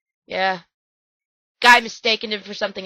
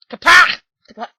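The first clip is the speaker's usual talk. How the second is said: Kaplach again, higher and much louder, rising; harsh Klingon shout; 7 semitones higher, 11 dB louder than usual. Retold or imitated. Host straining at a Klingon word, louder. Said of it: "I can't do it anymore, my throat hurts".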